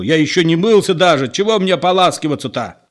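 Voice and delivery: loud, high angry follow-up; angry yelling. A narrator's reading. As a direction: keep shouting at him. Petro keeps yelling.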